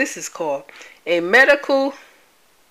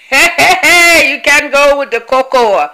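excited protest; 6 semitones higher, 9 dB louder than usual. Acted. Joking protest about chocolate.

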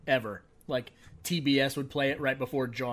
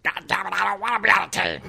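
fast, loud, higher angry cop line; gruff cop impression; 9 semitones higher, 8 dB louder, much faster than usual. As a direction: shout it like a fed-up cop. Nick Nolte impression in trailer.

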